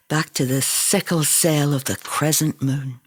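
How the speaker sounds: ordinary delivery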